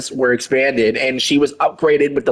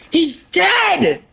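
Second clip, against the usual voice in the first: mock shock.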